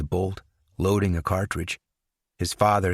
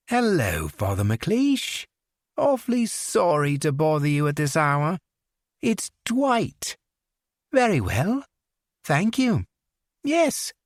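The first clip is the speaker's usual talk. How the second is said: higher, mock-polite English accent; English accent on the phone; 9 semitones higher than usual. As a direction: sweetly invite him in an English accent. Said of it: "lapsed into his English accent".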